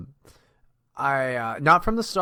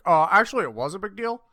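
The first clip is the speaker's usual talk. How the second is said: mocking; 9 semitones higher, 8 dB louder than usual. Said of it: "You're gonna be like".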